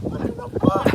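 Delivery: high, loud what-the-fuck; mock disbelief. Son reacting to mom's Halloween dinner plan, shocked and loud. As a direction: blurt it out in shock. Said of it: "And I was like".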